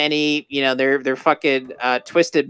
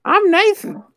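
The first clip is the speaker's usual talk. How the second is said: squeaky high hero voice; silly high goofy voice; high cartoon voice; 4 dB louder than usual. Mocking Uncharted hero.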